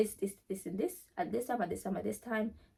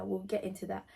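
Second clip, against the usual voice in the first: normal talking voice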